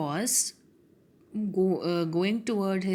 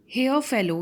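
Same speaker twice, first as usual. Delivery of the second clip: a louder, higher call to the fellow; cried out; 5 semitones higher, 5 dB louder than usual. Acted. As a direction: call out sharply to the stranger. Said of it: "he cried".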